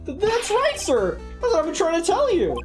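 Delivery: high, loud rookie cop insisting; eager young patrolman. Acted. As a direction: pitch it up and plead eagerly. Voices patrolman character in game.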